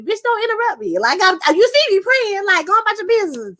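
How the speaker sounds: high-pitched, irritated telling-off; mock indignant retort. Praying woman telling an interrupter off, mock indignant retort. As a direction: scold the interrupter with playful outrage.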